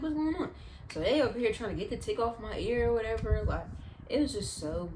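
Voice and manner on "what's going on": high, mildly panicked question; retold panic. Child scared of the tweezers, mock panicking. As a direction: imitate panicked confusion without real crying. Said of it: "start panicking, start crying".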